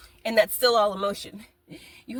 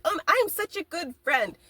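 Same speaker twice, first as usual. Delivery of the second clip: smug mock voice; 8 semitones higher, 3 dB louder than usual. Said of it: "people who are like".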